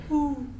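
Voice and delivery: imitated owl call, pitched high; owl hoot. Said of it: "owls murmuring".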